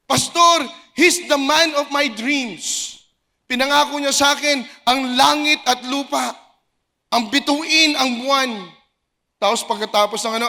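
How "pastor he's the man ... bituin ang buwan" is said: high, loud and slow, dreamy gushing; hammed-up lovesick churchgoer gushing to the pastor; mock lovestruck girl. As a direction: swoon over him like a smitten girlfriend. Mock congregant gushing about boyfriend.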